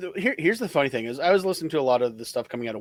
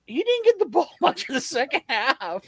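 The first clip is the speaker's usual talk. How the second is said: high-pitched, laughing disbelief; 13 semitones higher than usual. Real, not acted. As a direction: laugh through the words. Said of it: "I had to laugh".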